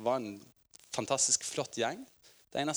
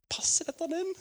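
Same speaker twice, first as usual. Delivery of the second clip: a high, breathless whisper of doubt, as if embarrassed to ask; hushing the question so nobody hears; whispered aside. Retold or imitated. Preacher whispers his own doubt while laughing during prayer.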